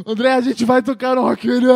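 loud, high shout down the phone; acted-out excited holler to a friend; yelled phone news. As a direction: yell it over festival noise. Yelling news to friend at festival.

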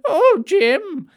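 high, loud soap-opera matriarch cry of oh Jim; Dot Cotton impression. Host does Dot Cotton calling to Jim, high and loud. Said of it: "like Dot Cotton".

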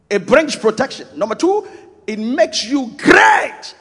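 loud, high, crying shout of the points; preaching shout. Real, not acted. Preacher shouting the blessing points to his flock. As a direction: proclaim it at full force to the congregation.

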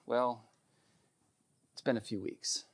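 low, very soft, halting admission; sheepish. Retold or imitated. Friend confessing to the pastor's wife, sheepish and hushed. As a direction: admit it sheepishly, with a hesitant gap. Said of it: "she sheepishly said".

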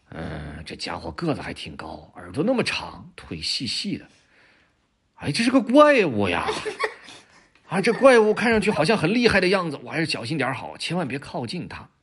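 先压低声音打量, 再高声叫"怪物"; 偷看的老虎从嘀咕到吓一跳; 老虎由小心变惊慌. Acted. 老虎偷看驴, 越看越怕. (老虎先悄声后惊叫)

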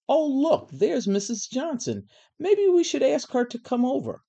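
high, loud show of noticing; pointed exclamation. A narrator's reading. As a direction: announce it pointedly, pitch well up. Pointedly spotting the lunchroom aide.

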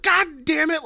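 very high, loud caught-off-guard yelp; pop exclamation. Retold or imitated. Showing listeners his classic pop reaction, high yelp. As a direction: yelp like a joke just got you. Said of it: "when I go like".

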